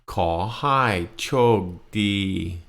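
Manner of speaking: Khaw hai chok di drawn out syllable by syllable; very slow model. Shown as a demonstration. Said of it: "Repeat after me".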